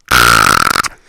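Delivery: low, croaking mock scream. Listener's character running down the road, low mock scream. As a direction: let out a rough, throaty fake scream. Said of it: "you run down the road screaming".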